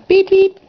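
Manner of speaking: high, loud toy-horn beeps. Acted. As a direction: toot like a little engine's horn. Engine sounding its horn goodbye.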